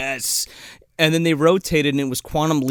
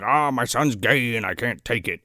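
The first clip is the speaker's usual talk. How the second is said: lower, put-on lament of a dad; mock-tragic dad voice; mock distraught father; 4 semitones lower than usual. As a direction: ham up a father's anguish. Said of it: "he's not like".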